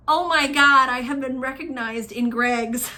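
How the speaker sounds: loud, giddy retold gasp of realisation; thrilled disbelief. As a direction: hit the opening loud, amused at yourself. Her own thrilled thought, retold.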